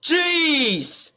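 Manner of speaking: loud, exasperated scolding; angry rant. Real, not acted. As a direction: scold him, fed up and loud. Ranting at Otis.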